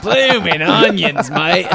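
loud, drawn-out fake Australian with a Cockney twang; hammed-up Australian accent; bad Aussie-Cockney accent. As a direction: ham up a terrible Cockney-sounding Aussie accent. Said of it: "as Australian as Wackerly's Cockney accent".

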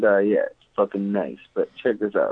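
ordinary delivery

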